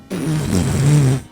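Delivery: imitated crash of a toppling tree; falling tree noise. Telling how the whole tree fell, imitated crashing noise. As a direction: make the noise of a tree coming down. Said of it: "this whole tree just went".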